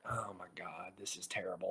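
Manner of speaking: breathless horrified whisper. Retold or imitated. Watching a fight from afar, horrified whisper. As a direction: hiss it under your breath in dread. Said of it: "So I'm like".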